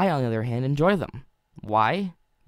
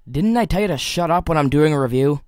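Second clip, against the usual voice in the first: louder, irritated telling off; the reviewer snapping at his fanboy side; loud mock-stern rebuke; annoyed scolding; 3 semitones higher, 8 dB louder than usual. Scolding inner fanboy again.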